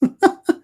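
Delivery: brief high-pitched laugh; short laugh. Real, not acted. Debater pauses in arguing with Uthman, short high laugh. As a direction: laugh briefly between arguments.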